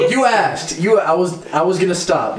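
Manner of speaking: high laughing you asked; laughing protest. Real, not acted.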